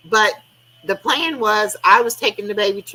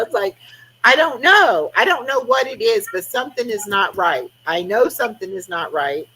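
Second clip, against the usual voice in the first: ordinary talk, nothing marked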